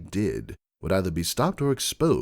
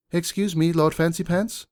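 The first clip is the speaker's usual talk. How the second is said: higher voice of a secretary over an intercom; mayor on the intercom; 9 semitones higher, 4 dB louder than usual. Acted. Secretary calling her lord over the intercom, louder. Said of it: "said the mayor on my intercom".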